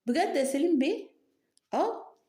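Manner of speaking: mock amazement. Feigned surprise at Salim's boast.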